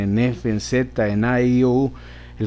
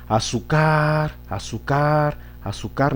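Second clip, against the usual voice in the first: Azúcar dragged out slowly with the weight misplaced; wrong stress tried; 4 semitones higher, much slower than usual. Shown as a demonstration. Teacher modelling a wrongly stressed word for pupils.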